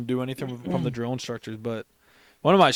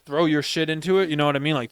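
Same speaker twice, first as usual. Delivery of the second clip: ordinary delivery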